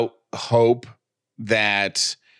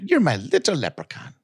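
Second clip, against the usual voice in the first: higher-pitched, lightly mock-Irish teasing; attempted Irish accent; 4 semitones higher than usual. Host prompting co-host to repeat it, playful mock Irish accent. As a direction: coax them with a playful put-on Irish accent. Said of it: "in your best Irish accent".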